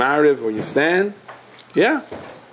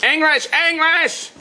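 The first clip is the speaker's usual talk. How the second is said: much higher, slow, drawn-out mock cry of an old woman; old lady demanding; slower than usual. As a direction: stretch each word like a frail lady insisting.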